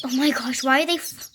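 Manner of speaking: ordinary talk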